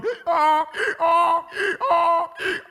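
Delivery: loud, high hee-haw bray; loud donkey imitation. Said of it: "The donkey was going".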